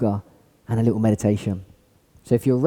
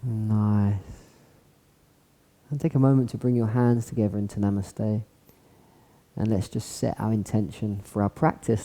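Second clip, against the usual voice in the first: guided meditation; 3 semitones lower, 6 dB quieter than usual. Acted. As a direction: melt them into calm. Guides a body scan relaxation.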